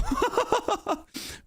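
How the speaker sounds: high giggly laugh; amused laugh. Real, not acted. Commentator reacts to a chaotic game, amused laugh. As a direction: laugh at the madness of it all.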